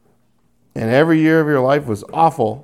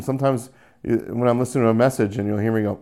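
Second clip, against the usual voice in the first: ordinary talk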